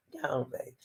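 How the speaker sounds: a quick breathy whispered aside. Real, not acted. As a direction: whisper it to the audience.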